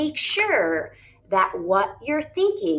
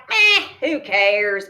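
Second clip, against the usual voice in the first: mock careless shrug; 8 dB louder, slower than usual. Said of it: "if you're like".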